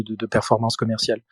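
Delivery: ordinary talk, nothing marked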